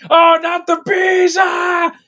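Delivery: hammed-up high screaming panic; over-the-top movie-star shriek impression; Nicolas Cage. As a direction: scream it out long and high, mock agony. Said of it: "Nicolas Cage".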